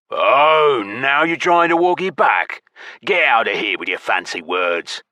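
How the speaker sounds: loud, high jeer easing down; mocking drawl in a creature voice; mocking goblin. A narrator's reading. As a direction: scoff at fine talk as a goblin. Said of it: "the goblin drawled mockingly".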